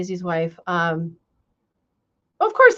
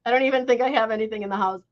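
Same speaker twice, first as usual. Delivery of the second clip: normal talking voice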